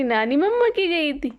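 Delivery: usual speaking voice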